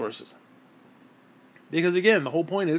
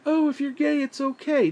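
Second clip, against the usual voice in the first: mock soothing parent; 10 semitones higher, 4 dB louder than usual. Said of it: "It's like".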